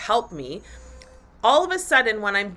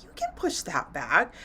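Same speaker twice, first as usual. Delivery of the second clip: a softer, tempting little ego voice; inner ego voice; 4 semitones lower, 6 dB quieter than usual. Retold or imitated. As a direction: let the lazy ego coax you. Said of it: "Ego was like".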